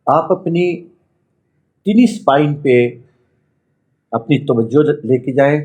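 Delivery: measured meditation voice with long pauses; guided meditation. Acted. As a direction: guide them inward, phrase by phrase. Leads a chakra meditation.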